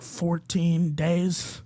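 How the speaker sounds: very slow, stretched-out estimate; drawn-out admission. Retold or imitated. Late employee answering boss on the phone, drawn out. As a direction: drag each word out slowly. Deadpan punchline to his boss.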